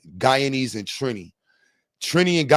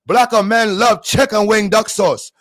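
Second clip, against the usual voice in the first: put-on higher-pitched, chopped-letter Chinese accent; put-on Chinese accent with cut-down sounds; mock Chinese accent; 6 semitones higher, 5 dB louder than usual. Streamer cutting letters on a retry, high mock Chinese accent. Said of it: "I gotta cut the letters a little".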